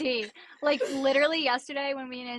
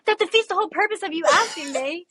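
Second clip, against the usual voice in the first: sassy retort; 7 semitones higher, 5 dB louder than usual. Retold or imitated. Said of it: "I'm like".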